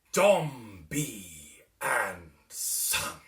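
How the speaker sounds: slow, savouring, loud and relishing; luxurious satisfaction. A narrator's reading. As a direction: roll the name out as if inhaling flowers. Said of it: "in a tone of luxurious satisfaction".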